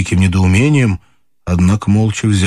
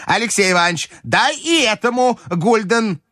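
бабушка кричит; 13 semitones higher than usual. Acted. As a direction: call out high and insistent. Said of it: "закричала бабушка". (высокий настойчивый оклик бабушки)

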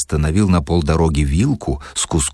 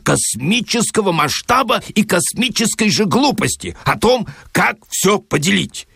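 much higher furious tirade; outshouting; 10 semitones higher than usual. Acted. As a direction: roar him down, higher and higher. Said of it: "перекричал Филипп Филиппович". (Филипп Филиппович перекрикивает, гораздо выше)

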